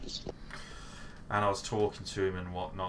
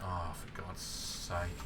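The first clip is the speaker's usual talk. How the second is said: muttered dismay; 2 semitones lower, 9 dB quieter, much slower than usual. Retold or imitated. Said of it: "I was like".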